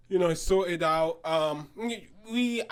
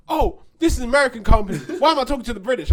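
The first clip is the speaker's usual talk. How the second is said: annoyed customer; 5 semitones higher, 7 dB louder, much faster than usual. Retold or imitated.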